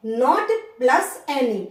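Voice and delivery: very slow, each word apart; slow emphasis. Shown as a demonstration. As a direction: spell out the breakdown. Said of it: "teacher explains no". (धीरे-धीरे ज़ोर देकर)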